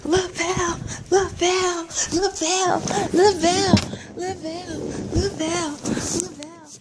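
high, repeated name-calling. Real, not acted. Calling repeatedly to a young child.

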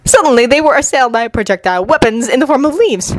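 usual speaking voice

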